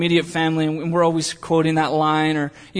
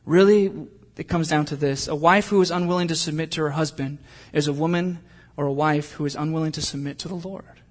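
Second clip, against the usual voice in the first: ordinary talk, nothing marked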